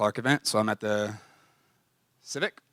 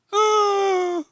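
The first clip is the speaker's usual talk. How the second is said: frustrated cry; 8 dB louder than usual. Retold or imitated. Frustrated cry after boss hangs up.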